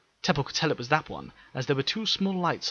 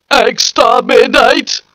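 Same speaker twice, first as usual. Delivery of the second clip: much higher, louder, slow robotic shout; Dalek shout; 13 semitones higher, 18 dB louder, much slower than usual. Acted. Dalek firing on the crowd, shrieking command. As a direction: stretch the word into a screech. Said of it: "the Dalek shouted".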